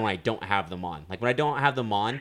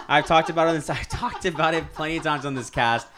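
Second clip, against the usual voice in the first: higher, louder, talking through laughter; laughing protest; 5 semitones higher, 4 dB louder than usual. Real, not acted. Lifter teased about his bench, protesting through laughter. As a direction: protest while laughing at yourself.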